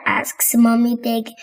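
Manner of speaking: plain reading voice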